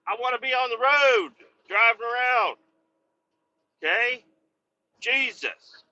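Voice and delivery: high, exasperated retort; mock exasperation. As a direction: scold the boss in jest. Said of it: "I'm like".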